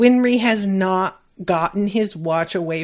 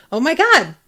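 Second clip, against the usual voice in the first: surprised gasp; 7 semitones higher, 5 dB louder than usual. Real, not acted. Reads a surprising chat comment.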